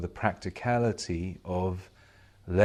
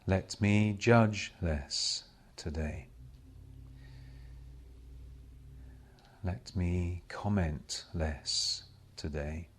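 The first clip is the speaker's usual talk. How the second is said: slow, hushed prayer with long silences; meditative prayer. Real, not acted. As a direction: pray each short petition, leaving space after it. Meditative prayer of self-surrender.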